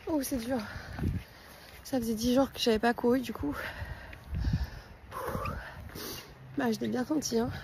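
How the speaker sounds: panting after the run, soft; breathless, legs gone; out of breath. Real, not acted. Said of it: "Mon cœur, mon souffle, tout".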